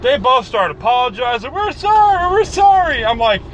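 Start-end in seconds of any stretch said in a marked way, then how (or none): 1.5-3.0 apologetic daughters. Retold or imitated. Daughters apologizing in retold story.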